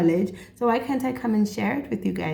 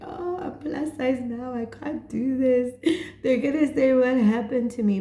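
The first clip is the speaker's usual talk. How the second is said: mock fretful self; 6 semitones higher than usual. Retelling her own worried thoughts.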